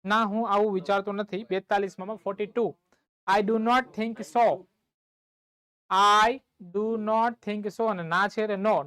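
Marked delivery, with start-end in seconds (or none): 3.3-4.5 measured, word-by-word teacher dictation; slow dictation. Shown as a demonstration. Dictating the English translation to class. 5.9-7.8 loud opening word, then very slow; slow teacher repeat for copying; word-by-word dictation. Shown as a demonstration.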